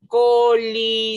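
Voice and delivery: COLISEO stretched as co-li-se-o; syllable by syllable. Shown as a demonstration. Teacher introducing a word to pupils, syllable by syllable. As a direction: draw out each syllable of COLISEO. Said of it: "¿Cuántas sílabas tiene coliseo?".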